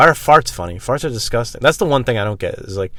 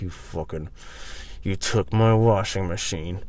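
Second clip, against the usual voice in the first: seething mutter; 2 semitones lower, 6 dB quieter, slower than usual. Retold or imitated. Retold muttered fury.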